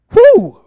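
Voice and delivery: high, loud "whew"; sudden high exhalation before preaching; loud whoop. Real, not acted. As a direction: let out a sharp, high whoop. Pauses before reading the psalm.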